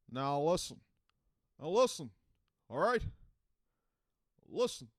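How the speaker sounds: halting politician speech, pitched high and projected; Obama. Acted. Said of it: "that's all I got for Obama".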